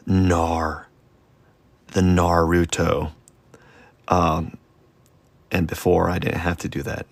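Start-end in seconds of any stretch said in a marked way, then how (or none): 0.1-3.1 "Nar" held on its final R, then "Naruto" slowly; emphasized R. Shown as a demonstration. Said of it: "Now I gotta emphasize the R".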